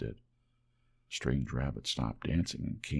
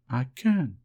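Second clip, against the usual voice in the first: high, louder teasing rabbit voice; doubting furry rabbit; 7 semitones higher, 6 dB louder than usual. A narrator's reading. Wild rabbit doubts the toy rabbit, high teasing voice. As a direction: tease him in a high rabbit voice. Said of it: "said the little rabbit".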